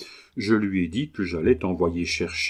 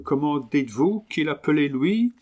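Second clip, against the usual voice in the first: higher-pitched Englishman speaking broken French; English accent; 5 semitones higher than usual. Acted. Englishman haggling over his new dog, English accent. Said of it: "continua l'Anglais".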